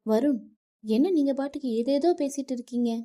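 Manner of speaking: wife shouting at her husband over her father; angry shouting. Acted. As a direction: yell at him for insulting her father. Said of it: "Nithya வருணிடம் கத்தினாள்".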